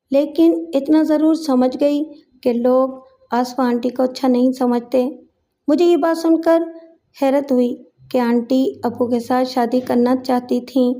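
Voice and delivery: usual speaking voice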